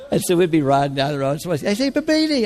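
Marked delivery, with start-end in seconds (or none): 1.7-2.5 calling out. Retold or imitated. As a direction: hail someone from a car. Street greeting called out.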